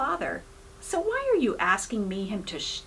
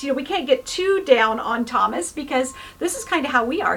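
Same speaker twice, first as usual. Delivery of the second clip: ordinary talk, nothing marked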